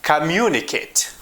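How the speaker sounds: "Communicate" stressed on MU, falling after; "Communicate" as com-MU-ni-cate, a careful word demo; stress on middle syllable. Shown as a demonstration. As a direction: lean on the second syllable of "Communicate". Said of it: "almost always in the middle".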